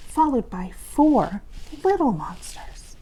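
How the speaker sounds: plain reading voice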